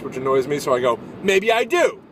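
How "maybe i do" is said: high, loud, defiant retort; flustered shout. Retold or imitated. Actor answering a heckler about his face, flustered high shout. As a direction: shout back with a lame comeback. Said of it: "So I just shout unintelligibly".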